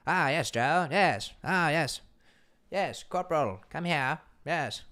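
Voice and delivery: put-on posh British accent, high and booming; posh British officer. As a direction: play a pompous British officer calling his men. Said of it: "He does have kind of British".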